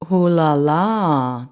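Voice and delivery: very slow, drawn-out leering ooh la la; sick leering purr. A narrator's reading. The rat mocks Ellie's French, slow leer. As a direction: savour it like a creepy villain. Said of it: "a sick, twisted smile".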